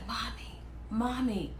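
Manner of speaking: small, hushed voice mimicking an awed child; imitated little kid. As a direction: softly call for mommy. Imitates little kids seeing her.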